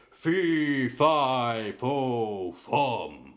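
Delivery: low, slow, sinking giant boom. Shown as a demonstration. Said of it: "really play with that giant voice".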